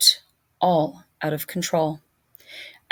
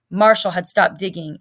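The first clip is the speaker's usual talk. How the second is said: plain reading voice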